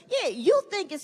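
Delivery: usual speaking voice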